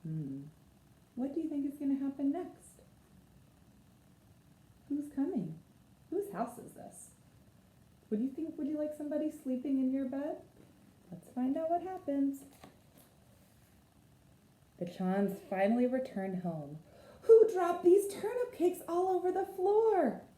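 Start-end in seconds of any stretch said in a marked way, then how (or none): 1.1-12.4 put-on higher, softer questions to kids; lively storyteller asking the children; storytime questions. Asks the listening children. 17.2-20.2 high, louder indignant cry; exclaiming. A narrator's reading. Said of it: "exclaimed Missus Chan".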